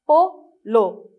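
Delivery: "Polo" as PO, pause, LO; syllable by syllable. Shown as a demonstration. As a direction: split "Polo" into two separate beats. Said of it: "lisez avec moi".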